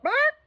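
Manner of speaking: a single high, loud dog bark; one loud bark. Acted. Jip wakes himself with one loud bark. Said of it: "he barked loud and woke himself up".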